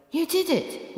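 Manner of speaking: high, hushed triumph; Cleo whispering. A narrator's reading. Cleo telling the narrator of their success, high and hushed. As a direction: breathe it with delight. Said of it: "whispered Cleo".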